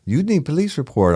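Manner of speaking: high mocking voice of the agent. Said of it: "and she's like".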